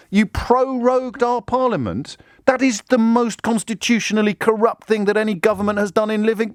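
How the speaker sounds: high, emphatic indignant protest; acted outrage. Objector accuses government of proroguing parliament, acted outrage. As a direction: protest in rising disbelief until interrupted.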